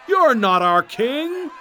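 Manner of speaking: high, loud, slow sneer. Acted. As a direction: jeer up at him with contempt. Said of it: "they sneered".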